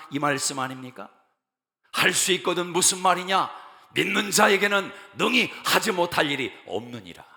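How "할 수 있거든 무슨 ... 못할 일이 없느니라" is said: booming preacher's declaration; loud proclamation. Acted. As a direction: proclaim the verse loudly and higher. Preacher proclaims the verse loudly.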